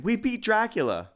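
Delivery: high, loud victory cheer; excited cry. A narrator's reading. Tim cheers that they beat Dracula, excited cry. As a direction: shout it jubilantly. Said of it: "cried Tim".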